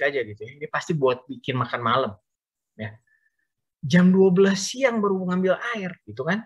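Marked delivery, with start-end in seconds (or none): 3.9-6.4 incredulous. Shown as a demonstration. Preacher mocking the woman's noon errand, incredulous. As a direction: pitch climbs high in mock disbelief at "siang". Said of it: "kebangetan kan?".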